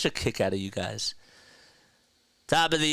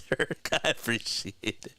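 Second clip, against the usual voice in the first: ordinary talk, nothing marked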